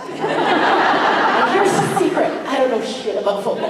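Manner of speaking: high, slow, laughing; laughing confession. Real, not acted. An actress laughing as she admits a secret to fans. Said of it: "confessing while laughing".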